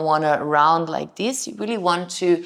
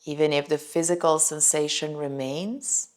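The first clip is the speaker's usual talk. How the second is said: calm, softer voice with long pauses; guided meditation; 5 dB quieter than usual. Shown as a demonstration. Yoga teacher inviting students into a body scan, guided meditation. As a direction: lead gently through a body scan, settling softer as it goes.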